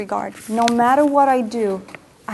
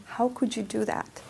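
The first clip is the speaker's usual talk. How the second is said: much softer repeat of the accusation; quiet echo; 8 dB quieter than usual. Retold or imitated. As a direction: let the blame sink down to a murmur. Quiet echo of self-blame.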